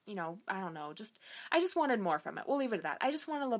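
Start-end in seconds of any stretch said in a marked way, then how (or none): 0.1-1.2 whisper. Real, not acted. Muttered aside, unvoiced.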